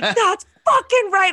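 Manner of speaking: high, loud triumphant yell, slowed and drawn out; screaming in their face. Retold or imitated. Patriots fan screaming at taunting bar rivals, high loud yell. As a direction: scream it right in the rivals' faces. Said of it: "I'm, like, screaming in their face".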